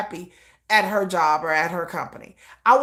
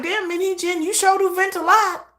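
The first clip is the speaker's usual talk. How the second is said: sarcastic jab; 7 semitones higher than usual. Retold or imitated. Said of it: "I'm like".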